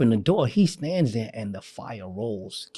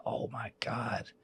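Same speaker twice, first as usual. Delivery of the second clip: whispered in dismay; hushed dismay on hearing his wife died; 15 dB quieter, slower than usual. Retold or imitated. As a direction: breathe it out under your breath. Felt so bad, whispered.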